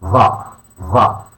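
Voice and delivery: VA as a dark, resonant DHA; emphatic Arabic Z. Shown as a demonstration. Teacher showing learners the letter, VA as emphatic DHA. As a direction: say VA with a heavy voiced TH. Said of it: "an emphatic Z".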